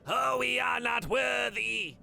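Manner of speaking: high, loud, slow wailing worship; grovelling madcaps. Acted. Madcaps bow before Cyrus, loud and slow. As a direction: wail it like worshippers. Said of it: "the other three behind him".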